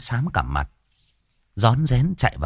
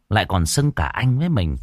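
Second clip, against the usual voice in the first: ordinary delivery, nothing marked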